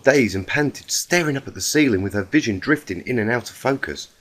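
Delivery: plain reading voice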